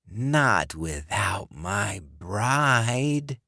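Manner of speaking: slow, breathy rasping whisper of a corpse; undead John. Acted. Dead ex-boyfriend luring Alice, slow rasping whisper. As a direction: hiss it through the door like a dead man.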